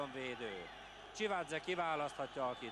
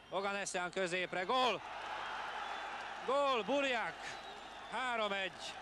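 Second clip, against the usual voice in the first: excited goal call; 5 semitones higher than usual. Real, not acted. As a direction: shout the goal. Goal scored.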